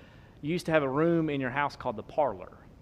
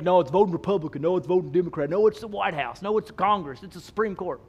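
higher, insistent run of objections; mock objectors; 4 semitones higher, 6 dB louder than usual. Preacher voices congregation's wrong answers, mildly mock-insistent. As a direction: lightly voice people arguing back, one after another.

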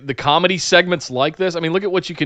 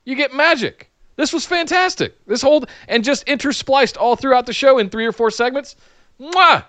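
excited; 8 semitones higher than usual. Real, not acted. Raving about the comedy segments.

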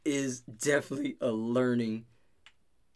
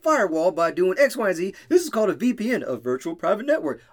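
higher, louder, eager know-it-all kid impression; kid explaining tech; 7 semitones higher, 5 dB louder than usual. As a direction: play a kid showing off secret knowledge. Acts out kid explaining tech secrets.

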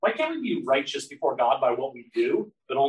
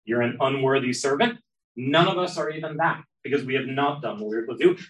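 normal talking voice

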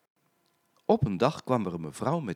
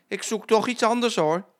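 high, loud demanding lady; fussy lady customer; 11 semitones higher, 6 dB louder than usual. A narrator's reading. Lady changing her mind to Jetje, high fussy voice.

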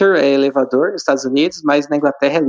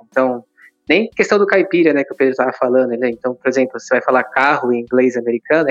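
ordinary delivery

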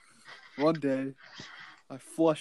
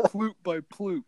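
ordinary talk, nothing marked

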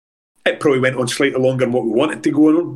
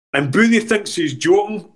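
usual speaking voice

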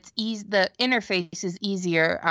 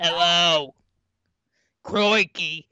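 lightly put-on Australian accent; 3 semitones lower, much slower than usual. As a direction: say it like a casual Aussie. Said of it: "He's Australian".

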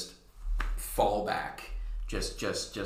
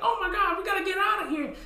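much higher squeaky panic; panicked clown; faster than usual. Acted. Clowns piling into the police wagon, high squeaky panic. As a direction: squeal like a fleeing cartoon henchman. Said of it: "It's like".

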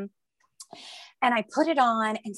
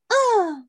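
high, loud straining grunt; imitated pushing grunt; 11 semitones higher, 5 dB louder than usual. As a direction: act out one push.

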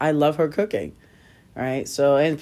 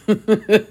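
short laugh; 6 semitones higher, 7 dB louder than usual. Real, not acted. Laughing at her own admission.

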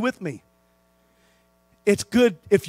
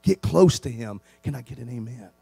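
hushed pastoral appeal; 9 semitones lower, 5 dB quieter than usual. Acted. Pastor pleading softly with his flock to get close to God. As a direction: draw the congregation close, gently.

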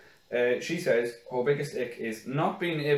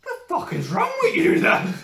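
high, loud mock retort; mock coworker voice; 7 dB louder than usual. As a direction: bark it as the coworker. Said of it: "And she's like".

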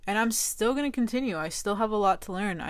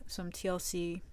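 ordinary talk

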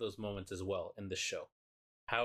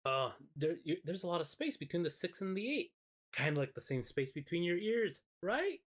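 sugary mock insult; 8 semitones higher than usual. Yumiko's sweet insult.

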